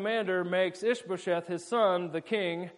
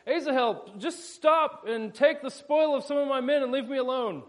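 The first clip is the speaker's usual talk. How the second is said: fleeing commander yelling back, pleading higher; yelling over the shoulder; 7 semitones higher, 3 dB louder than usual. Retold or imitated. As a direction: plead loudly with the chaser to stop. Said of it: "he yells over his shoulder".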